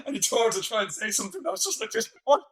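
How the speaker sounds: high, giggly talk through laughs; laughing while talking. Real, not acted. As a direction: speak while cracking up. Laughing at the memory.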